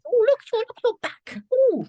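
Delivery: very high, squealing mock delight; imitated mum, high and gushing; admiring mum. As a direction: coo over it like a thrilled mum. Mum's admiring comments imitated.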